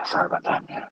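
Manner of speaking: normal talking voice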